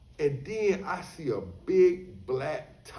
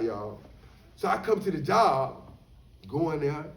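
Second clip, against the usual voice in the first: ordinary delivery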